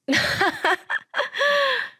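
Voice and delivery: a high, unhinged cackle; crazed laugh. Retold or imitated. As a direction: laugh like she's lost her mind. Wife seems to go crazy. (미친 듯한 높은 웃음)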